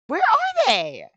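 high, loud, drawn-out wail of frustration; exasperated shout. Real, not acted. Player searching for a screaming character, exasperated high yell. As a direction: yell it out, stretching the words. Cannot find screaming person.

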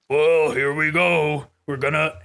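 slow, distorted voice-mod sound; slow voice-mod imitation. Streamer recalls the voice mod left on, slow distorted. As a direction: drag it out like a voice changer. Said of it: "it was just me going".

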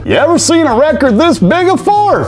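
high put-on voice, showing it off; showy amazement. Host showing viewers a giant disc, high and showy. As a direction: hold it up like a prize.

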